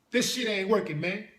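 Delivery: ordinary talk, nothing marked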